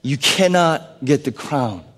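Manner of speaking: ordinary talk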